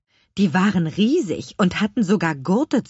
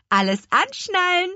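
high, merry command to passengers; cheerful call; 11 semitones higher than usual. A narrator's reading. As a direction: call it out gleefully, pitch lifted. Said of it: "rief Petronella vergnügt". (vergnügt gerufen)

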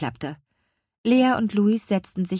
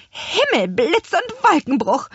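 high, loud startled curse; shocked witch outburst; 12 semitones higher, 6 dB louder than usual. A narrator's reading. Petronella hearing the mill is sold, startled and loud. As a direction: blurt it out in dismay. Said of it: "entfuhr es Petronella".

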